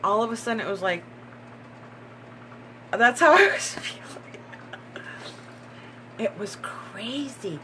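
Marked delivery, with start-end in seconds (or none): 2.9-4.4 high, loud laughing words; real giddy laughter. Real, not acted. Laughs recalling her reaction. 6.2-7.6 slow hushed whisper; real hushed awe; awed whisper. Real, not acted.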